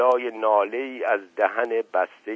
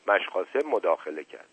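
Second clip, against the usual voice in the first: ordinary delivery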